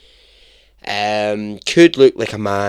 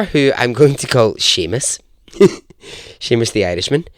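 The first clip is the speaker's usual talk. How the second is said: usual speaking voice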